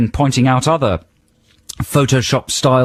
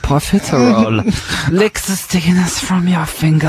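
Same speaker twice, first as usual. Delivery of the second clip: creepy singer parody; 7 semitones higher than usual. Acted. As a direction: purr it high like a sleazy singer. Mocking the song's creepy singer.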